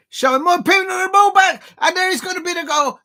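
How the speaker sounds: high, loud call of a near goal; live commentator's burst; excited yell. Real, not acted. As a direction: shout it as the ball flies in.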